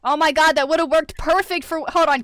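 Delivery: ordinary delivery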